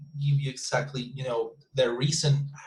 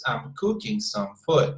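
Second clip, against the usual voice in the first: COOKING pitched high, then step down to a stressed FOOD; emphasis on two words; 6 semitones higher than usual. Shown as a demonstration. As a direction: punch "cooking" and "food", unstressed words kept light. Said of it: "emphasizing on these two sounds".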